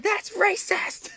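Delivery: very high, slow, drawn-out squeal; mock offended. Buff guy reacting to his anime parents, high slow mock outrage. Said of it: "He's like".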